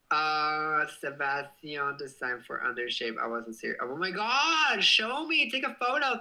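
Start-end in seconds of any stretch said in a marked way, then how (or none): 3.8-6.2 high loud eager plea; excited. Real, not acted. Streamer begging a viewer for a photo, high and eager. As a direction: beg to see it. Begs to see a design.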